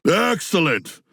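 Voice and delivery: sarcastic. Acted. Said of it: "But I mean it very sarcastically".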